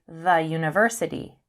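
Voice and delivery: THE as "thuh" before university; short THE, then the Y sound of university. Shown as a demonstration. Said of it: "So we say".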